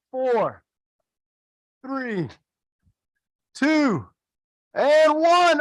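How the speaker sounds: high, eager count down to the buzzer; excited countdown. Real, not acted. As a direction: call the numbers up high like a timer. Counting down a timed catch drill.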